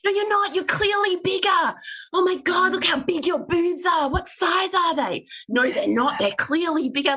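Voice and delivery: mock rude stranger. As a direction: parody tactless comments on a body.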